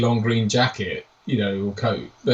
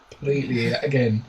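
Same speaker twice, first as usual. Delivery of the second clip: ordinary talk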